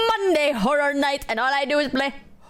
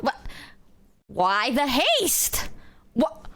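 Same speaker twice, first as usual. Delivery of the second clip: ordinary delivery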